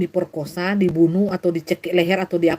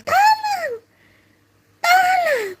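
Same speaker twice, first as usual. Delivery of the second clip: very high screamed cry; screaming at first; much slower than usual. Shown as a demonstration. Preacher shows a false victim's first cries, screaming high. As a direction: open with a piercing mock scream. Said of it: "awal-awalnya".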